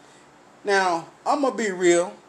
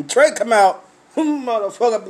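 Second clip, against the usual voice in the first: ordinary talk, nothing marked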